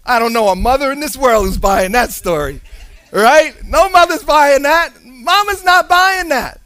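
normal talking voice

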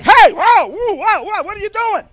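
loud, high startled whoa; startled mock yell. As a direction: recoil from a sudden light. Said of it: "people are like".

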